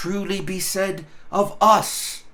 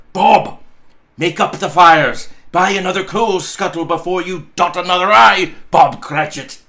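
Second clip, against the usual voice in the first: loud, jolly commands; hearty orders; 3 semitones higher, 8 dB louder than usual. Acted. Scrooge cheerfully ordering Bob to build the fire, loud. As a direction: bellow cheerful orders across the office.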